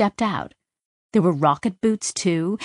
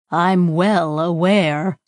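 dry, starting to laugh; much slower than usual. Acted. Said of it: "Tak was starting to laugh".

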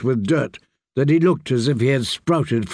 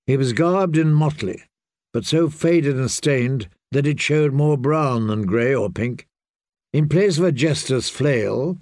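ordinary delivery, nothing marked

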